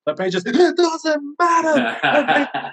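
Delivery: high, very slow, laughing "it doesn't matter"; teacher giving up. Retold or imitated. Teacher answering the prank question, high, slow and laughing. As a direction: drag it out like an exasperated teacher cracking up. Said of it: "And he's like".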